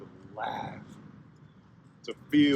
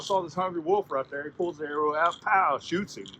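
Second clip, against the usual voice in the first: usual speaking voice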